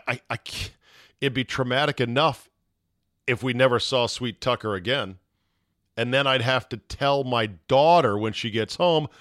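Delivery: usual speaking voice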